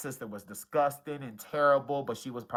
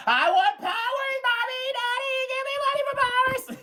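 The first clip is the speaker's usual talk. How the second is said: very high, sing-song spoiled princess; cartoon princess. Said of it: "talk like the princess in that episode".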